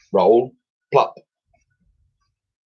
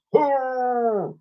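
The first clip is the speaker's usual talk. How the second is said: high, far-off creature hurrah; a mammoth joining the cheer. Acted. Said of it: "joined in Wooly".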